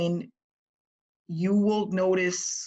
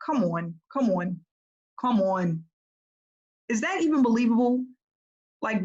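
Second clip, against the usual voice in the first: raised, louder disbelief at the pitch; incredulous; 4 semitones higher, 3 dB louder than usual. Real, not acted. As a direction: come on, is that even believable. Coach mocking a lifeless pitch.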